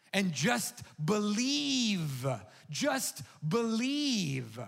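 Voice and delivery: drawn-out pleading repetition; slow plea. Real, not acted. Pastor urging the congregation to believe, slow and pleading. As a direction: stretch each believe slowly.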